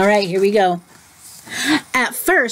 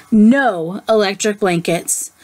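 ordinary delivery, nothing marked

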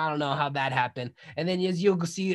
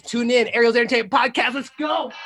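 hype call; 6 semitones higher, 6 dB louder than usual. Real, not acted. Host hyping the podcast.

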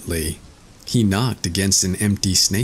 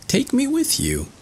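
much higher, eager little voice; eager Tom Thumb; 10 semitones higher than usual. A narrator's reading. Tom Thumb to the thieves, high eager offer. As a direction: pipe up to offer help.

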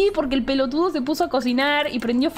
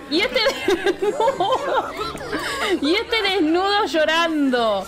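laughing disbelief; 4 semitones higher, slower than usual. Real, not acted. Laughing at naked crying sim.

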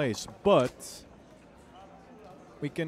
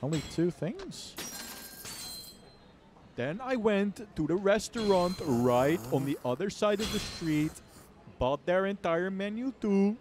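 hyped YouTuber; 6 semitones higher than usual. Pretend. Continues mukbang YouTuber bit.

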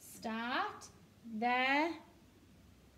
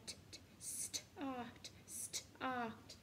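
whispered sounding out; 8 dB quieter, much slower than usual. Shown as a demonstration. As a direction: whisper "T, t, start", slowly. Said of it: "Can you hear the t in there?".

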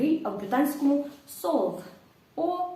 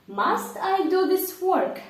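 higher, louder and slow, asking the class; 7 semitones higher, 4 dB louder, slower than usual. Shown as a demonstration. Teacher quizzing students, higher and louder. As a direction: put the question to the students.

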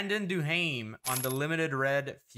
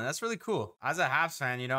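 ordinary talk, nothing marked

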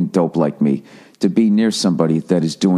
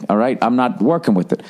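ordinary talk, nothing marked

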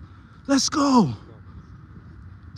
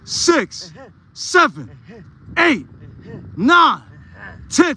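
counting reps; much slower than usual. Real, not acted. Counting reps aloud for lifter.

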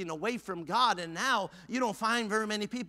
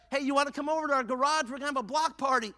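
quick, higher-pitched invitation; inviting neighbor; 8 semitones higher, faster than usual. Acted. Neighbor inviting churchgoer to a block party, calling out.

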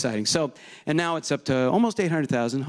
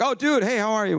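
much higher, bright burst of "dude, hey"; excited greeting; 8 semitones higher than usual. Retold or imitated. Meeting someone at a special event, high excited hello. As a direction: greet a buddy with a sudden lift in pitch. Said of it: "special events".

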